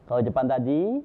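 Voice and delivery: normal talking voice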